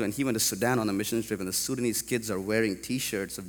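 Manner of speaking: ordinary talk, nothing marked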